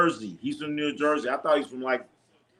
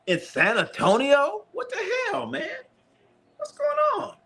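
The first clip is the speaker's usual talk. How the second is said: high, baffled cry of disbelief; incredulous. Real, not acted. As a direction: pitch shoots way up in disbelief. Disbelief at rival fan sighting.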